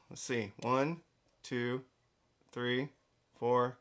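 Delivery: ordinary talk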